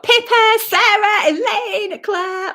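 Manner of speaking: high, loud, cheerful roll call; excited greeting. Real, not acted. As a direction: greet the viewers warmly. Greeting viewers in live chat.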